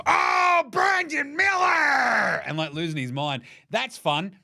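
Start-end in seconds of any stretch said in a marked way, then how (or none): 0.1-2.4 higher, louder hyped play-by-play yell, the name drawn out slowly; hyped announcer. Retold or imitated. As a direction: do the announcer losing his mind over the name. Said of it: "that awesome announcer going".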